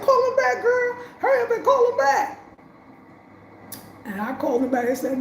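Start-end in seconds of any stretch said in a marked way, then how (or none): 0.0-2.4 much higher, louder, pressing hard; urgent sister. Retold or imitated. Sister on the phone urging her to call back, high and urgent. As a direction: hurry her, high and insistent.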